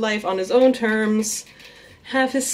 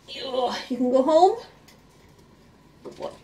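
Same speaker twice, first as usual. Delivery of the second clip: higher, sing-song pet voice; pet talk; 5 semitones higher than usual. Real, not acted. Owner coaxing her rat back home. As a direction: coax the rat home sweetly.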